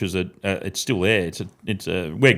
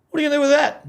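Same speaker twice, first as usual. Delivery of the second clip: pitched way up, asking in disbelief; high-pitched mock kid asking; high incredulous kid voice; much faster than usual. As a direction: squeak it out like a curious ten-year-old. Kid questioning uncle, retold.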